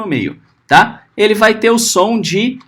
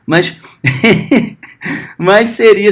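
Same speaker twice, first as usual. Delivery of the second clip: normal talking voice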